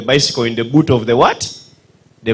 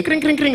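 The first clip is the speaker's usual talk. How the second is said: higher kring kring ring; bicycle bell; 11 semitones higher, 5 dB quieter than usual. Retold or imitated. Ogwere ringing the bell again at speed. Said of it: "Ogwere rings the bell again".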